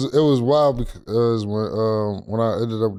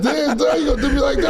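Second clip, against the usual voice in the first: much higher, laughing through the words; laughing quote. Retold or imitated. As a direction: crack up while retelling it. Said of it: "he said".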